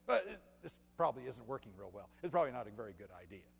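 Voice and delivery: low, quick, mumbly excuse; half-hearted backing out. Acted. Believers giving up when times get tough, half-hearted excuse. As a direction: talk yourself out of it, low and hurried. Voices people backing out of difficulties.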